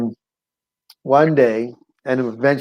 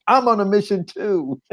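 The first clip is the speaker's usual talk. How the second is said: echoing comeback; 8 semitones higher than usual. Retold or imitated. Prostitute's echoing comeback acted out.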